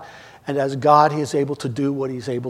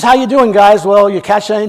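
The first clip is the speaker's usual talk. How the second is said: louder friendly call across the water; 3 semitones higher, 8 dB louder than usual. Retold or imitated. Jesus calling to fishermen from the shore, loud and friendly. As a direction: hail the boat from the shore.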